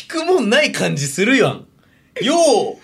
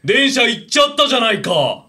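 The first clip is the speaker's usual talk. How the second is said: higher, indignant mock complaint; irate older man; 5 semitones higher than usual. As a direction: blame him like an outraged commuter. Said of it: "ちょっとおじさんやって".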